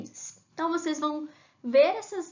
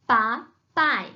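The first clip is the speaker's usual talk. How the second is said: "papai" split as pa, pai, each firm and louder; syllable by syllable; 5 dB louder than usual. Shown as a demonstration. Teacher giving the word to form, split into syllables. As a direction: punch out both syllables of "papai" separately. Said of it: "procurar as sílabas pra formar".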